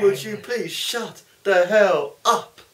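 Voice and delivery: high, loud, slow, drawn out with a rise on hell; Jericho catchphrase. Retold or imitated. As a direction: stretch it out like a heel mocking the crowd. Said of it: "I Attila Rock and Roller".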